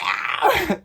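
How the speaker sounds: high squealing laugh; shrieking laugh. Real, not acted. A reader laughing at her own scream, high squeal.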